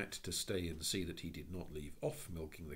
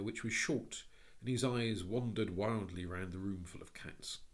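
plain reading voice

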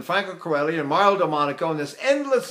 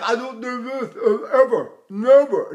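put-on muffled, hooty English; un-Italian swallowed voice; 3 semitones higher than usual. Shown as a demonstration. Teacher mocks what no Italian does, swallowed put-on voice. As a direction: swallow the words in a silly covered tone. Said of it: "No Italian does that".

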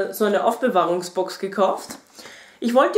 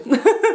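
high burst of laughter; 12 semitones higher, 6 dB louder than usual. Real, not acted. Laughing at own complaint.

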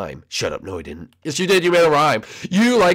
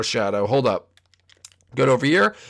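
normal talking voice

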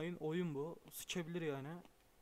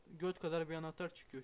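ordinary talk, nothing marked

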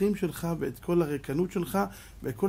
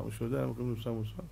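hushed question; 6 semitones lower, 9 dB quieter, much faster than usual. Shown as a demonstration. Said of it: "repeated question, quiet".

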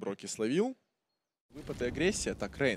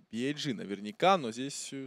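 ordinary talk

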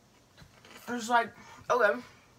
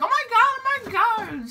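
mock squealing friends, much higher and louder; friends' shocked gasp; 7 dB louder than usual. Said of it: "And my friends were like".

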